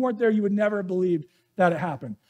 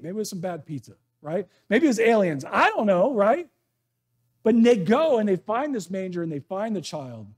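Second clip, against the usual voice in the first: normal talking voice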